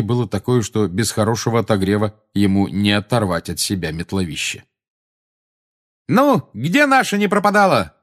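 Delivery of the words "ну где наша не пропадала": высокий возглас на подъёме; крикни высоко, бросаясь вперёд; лихой крик. A narrator's reading. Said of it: "крикнула Тонкс". (кричит)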